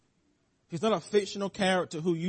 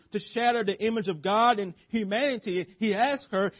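normal talking voice

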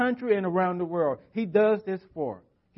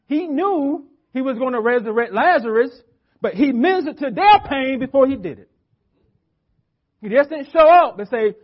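louder and higher, driving hard; full-force preaching; 7 semitones higher, 9 dB louder than usual. Real, not acted. Preacher to congregation about Jesus, fervent raised preaching. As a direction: build the point with rising fervor.

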